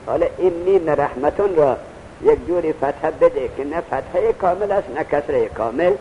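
ordinary talk, nothing marked

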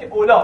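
اولا with open ā and no hamza; با قصر. Shown as a demonstration. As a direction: end اولا on the bare vowel. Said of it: "قصر رو تلفظ بکنید". («اولا» مقصور، بدون همزه)

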